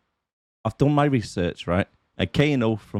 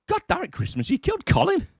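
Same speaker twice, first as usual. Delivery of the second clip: mock outrage. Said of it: "I was like".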